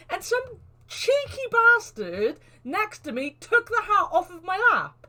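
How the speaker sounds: high, loud outrage with a laugh; indignant. Real, not acted. Streamer telling how a souvenir was snatched, indignant. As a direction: laugh through your high-pitched indignation. Mock outrage over stolen hat.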